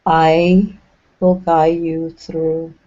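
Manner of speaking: measured, unhurried phrases, each left to settle; guided meditation. Acted. Leading the group along an imagined path in the clouds, guided meditation.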